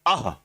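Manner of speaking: short breathed-out अह like a sigh of air; breathy visarga ah. Shown as a demonstration. Teacher sounding out the visarga breath, short and breathy. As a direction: push a short puff of breath: अह. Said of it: "ये विसर्ग है".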